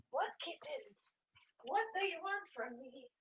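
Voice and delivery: calling to pets from far across the room; high, distant voice away from the mic. Real, not acted. Asking the kittens what they want, far off and exasperated.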